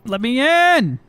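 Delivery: loud, rising holler; impatient shout. Pretend. Calling out to be let in.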